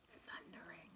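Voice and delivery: breathy suspense whisper; spooky storytime whisper. A narrator's reading. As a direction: whisper it like the giant might hear. Giant's footsteps, suspense.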